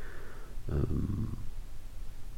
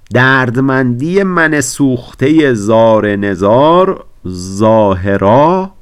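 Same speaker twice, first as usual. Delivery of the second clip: slow, louder reading of the verse; drawn-out, louder poem recital; emphatic recitation; 7 semitones higher, 9 dB louder, slower than usual. Acted. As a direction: declaim the line slowly and fully.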